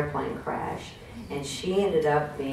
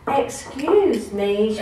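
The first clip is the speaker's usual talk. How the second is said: put-on very slow, higher, sweet Southern accent "Excuse me"; 6 semitones higher, much slower than usual. Congresswoman addressing the House floor, Southern accent. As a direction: drawl it out in a Southern belle accent. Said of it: "with a real southern accent".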